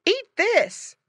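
high, loud coaxing wife's voice; farmer's wife. A narrator's reading. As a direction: coax the piglet to eat. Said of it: "called the farmer's wife".

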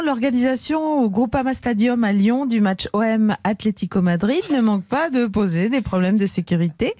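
usual speaking voice

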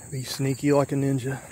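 ordinary talk, nothing marked

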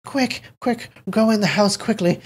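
high, anxious, trembling urging. A narrator's reading. Elderly man urging them into the house, trembling. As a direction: hurry them inside in a frightened high voice. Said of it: "said in a trembling voice".

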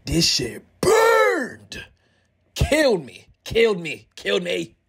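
strained, drawn-out groan. Real, not acted. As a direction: squeeze it out in pain. Workout pain.

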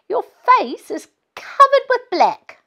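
put-on high, bright, teasing child; little girl voice. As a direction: play a giggly young girl. Girl teasing laughing mum.

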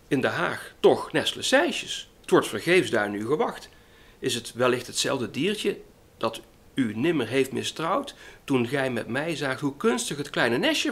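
lively, lilting poem reading with wide pitch swings; poem recitation. Acted. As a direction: recite a children's verse with emphatic, measured expression. Recites an old children's poem. (gedicht met veel expressie voordragen)

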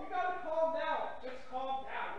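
usual speaking voice

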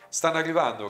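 a moderate cry of alarm; calling a warning. A narrator's reading. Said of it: "gridò Little Bear".

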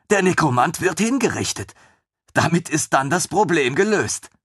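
put-on high, bright-eyed young officer, gushing; a thrilled lieutenant who can't believe his luck; eager lieutenant voice. Lieutenant gushing over the queen's griffin, high and eager.

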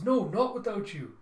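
high, loud, slow protest; drawn-out, high child's plea; pleading child. Acted. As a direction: refuse like a distressed kid. Child refuses to leave father.

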